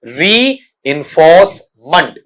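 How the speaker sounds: slow "reinforcement", split as री, इंफोर्स, मेंट; sounded out. Shown as a demonstration. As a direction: draw out "reinforcement" in separate chunks for learners. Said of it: "बेटा ये क्या बना". ("Reinforcement" को धीरे-धीरे हिस्सों में बोलें)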